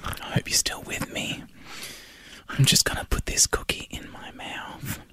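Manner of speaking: soft breathy ASMR whisper. Shown as a demonstration. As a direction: whisper close to the mic, ASMR style. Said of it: "I'm doing some A S M R".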